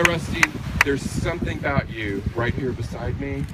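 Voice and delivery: normal talking voice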